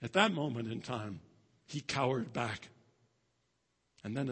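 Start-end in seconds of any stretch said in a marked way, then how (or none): none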